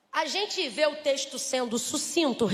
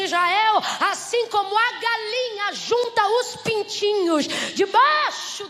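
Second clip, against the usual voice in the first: loud, high, slow proclamation; proclaiming; 6 semitones higher, 6 dB louder, slower than usual. Acted. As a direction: call out God's words. Deus fala a Israel. (proclamação alta e lenta)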